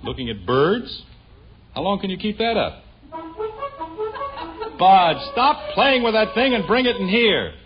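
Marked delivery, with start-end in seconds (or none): 4.8-7.5 high, loud, slow call; exasperated order. Acted. Parent ordering boy to bring the horn, exasperated. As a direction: call out firmly across the house.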